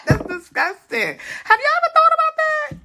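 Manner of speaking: high giggling laugh. Real, not acted. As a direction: laugh hard at the joke. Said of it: "that's such a dirty joke".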